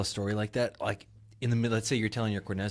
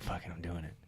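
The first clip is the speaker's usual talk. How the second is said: low, soft aside to himself; quietly retold inner decision; quiet resolve; 6 semitones lower, 14 dB quieter than usual. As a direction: say it under your breath.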